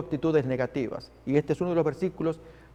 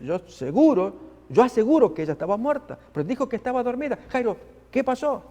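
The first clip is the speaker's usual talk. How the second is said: higher, fast, flustered doctor; insist, flustered and quick; 7 semitones higher than usual. Acted. The doctor protesting to Jairo, flustered. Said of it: "Y el doctor que dice".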